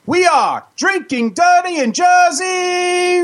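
slow, high, drawn-out shout; shouted show title. Acted. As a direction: belt the show name.

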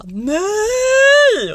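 long, high, loud yell; retold scream. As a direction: re-enact a panicked scream at full pitch. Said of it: "Då skrek jag". (återberättat skrik)

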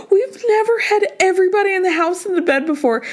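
high, fast gushing; excited squeal. Retold or imitated. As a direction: squeal it out, barely breathing. Excited girls in bed.

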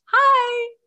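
put-on sweet little kid greeting. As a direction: chirp it like a small kid at the door. Child at the door.

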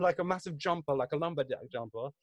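ordinary talk

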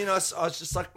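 normal talking voice

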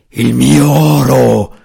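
slow, loud roaring dragon voice. A narrator's reading. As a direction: roar it out like a dragon. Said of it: "ruggì ripetutamente il drago". (ruggito lento e forte di drago)

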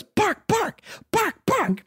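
high, excited little barks; toddler barking. Retold or imitated. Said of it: "at the dog and goes".

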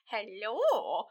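swooping, drawn-out soft hello; suggestive greeting. Retold or imitated. Wife turning up at Moliere's room, swooping soft hello. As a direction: let the hello swoop up and fall. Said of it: "She's like".

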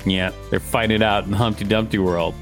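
usual speaking voice

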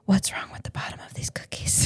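put-on whispered child's question. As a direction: hush it like a worried kid. Child whispering to her dad.

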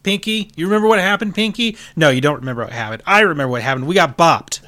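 high, loud mouse lecturing Pinky; scolding mouse. Acted. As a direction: scold your dim friend.

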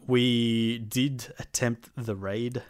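slow, weighty stress on "attempt". Real, not acted. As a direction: draw out "did attempt", leaning on "attempt". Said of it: "Attempt was the, uh, the emphasis on that word".

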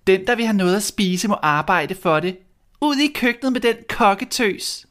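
put-on high, sneering spoiled girl; mean stepsister voice. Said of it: "sagde de onde piger". (høj, hånlig stemme som en ond stedsøster)

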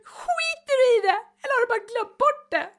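high, loud, sobbing retold outburst; wife crying down the phone at husband. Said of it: "gråter bara så ovärdigt".

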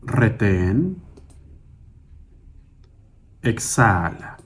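slow single-word cues, each vowel drawn out; calm, measured breath counting with long silences; guided breathing meditation. Acted. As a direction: pace someone's breathing, stretching each cue unhurriedly.

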